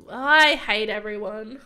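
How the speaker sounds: slow, loud, drawn-out exasperation; exasperated outburst. Real, not acted. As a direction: drag it out loudly in frustration. Raging at the game.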